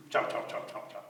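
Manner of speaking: soft, toneless rhythmic chomps; quiet repeated chomp; chomping sounds. A narrator's reading. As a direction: gnaw along under your breath. Imitated chewing on wood.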